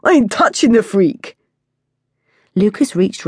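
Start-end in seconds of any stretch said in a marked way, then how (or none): 0.0-1.4 loud, higher scornful refusal; disgusted kid. A narrator's reading. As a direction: recoil from the freak.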